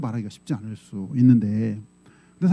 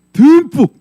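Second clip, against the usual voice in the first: 듬뿍 punched much higher and louder; stress on 듬뿍; 11 semitones higher, 11 dB louder, much slower than usual. Real, not acted. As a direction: hit 듬뿍 hard and high. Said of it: "emphasis on generous portion". (듬뿍을 높고 크게 강조)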